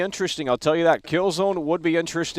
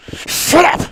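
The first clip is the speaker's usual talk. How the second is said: llama voice; 13 semitones higher, 7 dB louder than usual. Pretend. Snapping in the llama voice.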